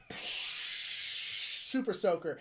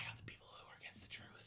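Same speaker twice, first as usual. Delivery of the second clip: secretive whisper; 22 dB quieter than usual. Pretend. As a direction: let them in on the secret in a whisper. Secretive spy aside.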